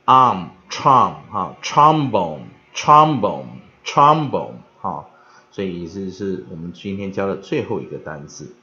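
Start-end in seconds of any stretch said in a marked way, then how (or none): 0.0-4.5 loud "arm", built up into a slow "trombone"; pronunciation drill. Shown as a demonstration. Teacher finishes building the last word, pronunciation drill. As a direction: hit "trombone" loudly, three measured times. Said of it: "O M 母子音".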